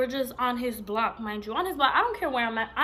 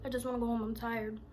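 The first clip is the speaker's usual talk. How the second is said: soft, understated brush-off; low-key attitude; 6 dB quieter than usual. Retold or imitated. Woman telling her date she wants home, low-key attitude. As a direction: let it drop softly, done with the night. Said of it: "I was saying it just like that".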